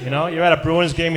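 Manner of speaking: ordinary delivery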